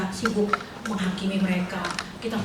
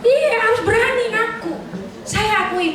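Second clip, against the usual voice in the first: much higher, bursting into laughter; high laughing admission; 11 semitones higher than usual. Real, not acted. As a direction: laugh it out while admitting it. Laughing after confessing a sin.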